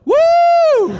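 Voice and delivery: very high, loud whooping cheer; the camp cheering, loud mock whoop; crowd cheer. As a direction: whoop like a whole camp celebrating. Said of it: "they all go".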